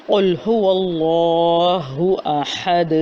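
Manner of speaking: slow, chanted قل هو اللہ احد with a heavy ق; Quranic recitation. Shown as a demonstration. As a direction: recite it in tajweed style, drawn out. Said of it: "سورہ اخلاص کی پہلی آیت ہے". (قل هو اللہ احد تجوید کے ساتھ تلاوت)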